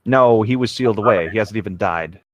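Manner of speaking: ordinary talk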